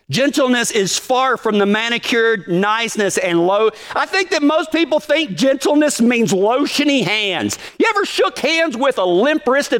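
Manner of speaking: high, pressing preacher voice riding up the pitch; fired-up preaching. Acted. Pastor to his cowboy congregation, fired-up preaching. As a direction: preach it with rising heat.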